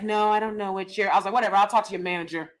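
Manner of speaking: ordinary delivery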